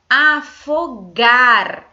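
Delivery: loud, very slow word. Shown as a demonstration. Teacher to class, stressing the ending gar: loud drawn-out word. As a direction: call out Afogar, loud and drawn out. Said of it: "terminam com gar".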